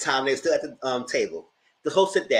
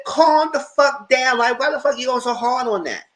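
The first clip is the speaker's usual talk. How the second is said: retold shout; 11 semitones higher, 5 dB louder than usual. Retold telling him off.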